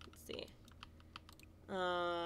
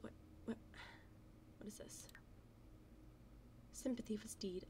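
soft unvoiced whisper; 13 dB quieter than usual. Real, not acted. Gamer whispering over a sad horse. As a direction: hush to a whisper while reading. Whispering while reading game.

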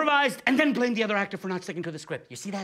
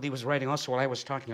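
ordinary delivery

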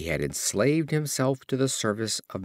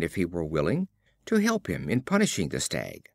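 ordinary delivery

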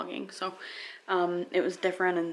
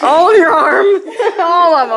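laughing; 10 semitones higher, 19 dB louder, slower than usual. Real, not acted. Echoing the slow demo, laughing.

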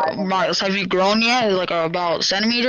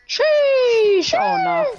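excited exclamation; 4 dB louder, much slower than usual. Real, not acted. Reacting to game event.

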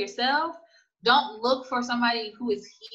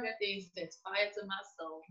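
quiet exasperation; 11 dB quieter than usual. Real, not acted. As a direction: say it under your strong feeling, softly. Venting about stereotyped image of Mexicans.

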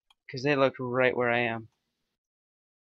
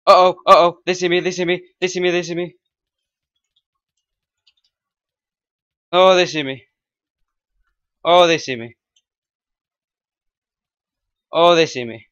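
panicked yelling; 4 semitones higher, 11 dB louder than usual. Real, not acted. Spotted by enemies, fleeing.

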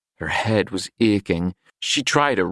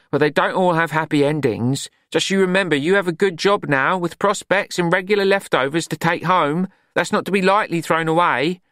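higher, firm motherly scolding; stern Glenda; 9 semitones higher than usual. A narrator's reading. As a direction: lecture her like a big sister. Glenda lecturing Juliet.

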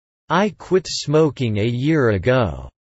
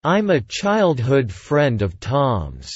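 slow, careful drill delivery; slow model reading; slower than usual. Shown as a demonstration. Teacher repeats the sentence slowly for students to copy. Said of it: "repetition drill, slow model take".

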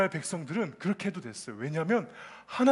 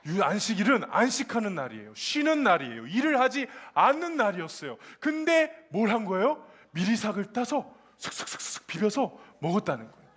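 ordinary talk, nothing marked

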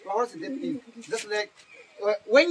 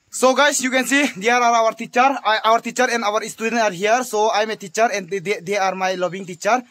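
vlog presenter; 8 dB louder than usual. Acted. Teacher turns to the camera to introduce his class, announcing loudly. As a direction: present it to your viewers.

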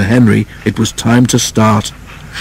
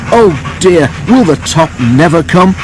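higher, strained and despairing; panting effort; 6 semitones higher than usual. A narrator's reading. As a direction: labour through it, struggling uphill. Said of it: "he panted".

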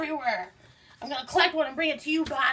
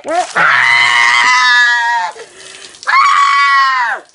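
loud, long scream; hammed-up horror scream; 3 semitones lower, 13 dB louder, much slower than usual. Grabbed in the basement, loud horror-movie scream. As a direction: scream like the monster's got you.

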